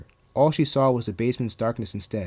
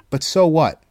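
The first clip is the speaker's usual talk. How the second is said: raised, forceful command; 4 semitones higher, 8 dB louder than usual. A narrator's reading. Counselor overruling the evil voice, commanding. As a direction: overrule them loudly with conviction. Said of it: "Mister Chimicki commanded".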